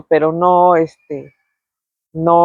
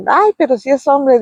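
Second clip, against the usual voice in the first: high, teasing scoff; mocking scorn; 8 semitones higher than usual. Mother teases the frightened painter, high mocking scoff. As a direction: scoff at him for being a man and scared. Mom mocks painter's cowardice.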